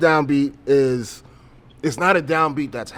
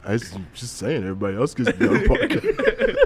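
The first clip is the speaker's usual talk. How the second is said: fast, laughing sulky whine; butthurt girlfriend voice; 3 semitones lower than usual. Retold or imitated. Girlfriend complaining everyone else gets on, fast laughing sulk. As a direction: pout it out, half laughing. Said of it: "butthurt voice".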